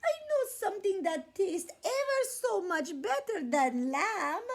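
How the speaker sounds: high, loud tearful lamb; pleading little lamb. A narrator's reading. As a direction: beg the coyote in a tiny voice.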